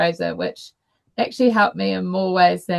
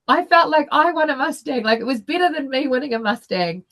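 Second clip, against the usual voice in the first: overjoyed; 7 semitones higher than usual. Real, not acted. Telling listeners how the winning felt, real overjoyed lift. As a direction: let the delight spill over as you say it.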